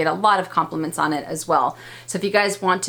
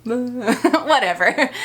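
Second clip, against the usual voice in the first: laughing; 6 semitones higher, much slower than usual. Real, not acted. Laughing dismissal of husband.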